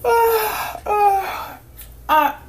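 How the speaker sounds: put-on high girl voice through a yawn. A narrator's reading. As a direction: ask it mid-yawn, sleepy. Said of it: "she asked him with another yawn".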